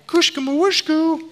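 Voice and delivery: very high cooing nonsense; baby talk. Retold or imitated. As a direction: squeak mock baby babble. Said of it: "either being treated like".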